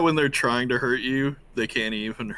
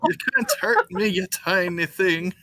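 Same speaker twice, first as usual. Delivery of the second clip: taunting giant; 9 semitones higher, 4 dB louder than usual. Acted. Player's character taunts a tiny attacker, high mocking voice. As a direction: sneer at the tiny foe.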